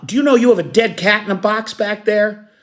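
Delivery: high, rattled cop's question; a cop unnerved by a dead cat; shaken cop. Acted. Shaken cop asks about cat.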